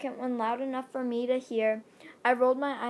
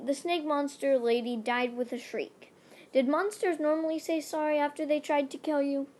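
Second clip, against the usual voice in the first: ordinary delivery, nothing marked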